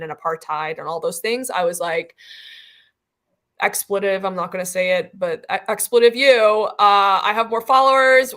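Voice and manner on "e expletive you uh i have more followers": mock defiant outburst. Mock retold defiance at critics.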